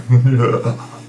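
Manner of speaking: villainous cackle; evil laugh. Acted. Game master gives players the laughter in the background, evil laugh. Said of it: "an evil laughter".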